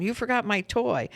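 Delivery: put-on high child's voice; a boy whining about his toy; little boy. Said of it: "Comes back in".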